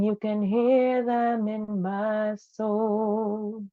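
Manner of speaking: normal talking voice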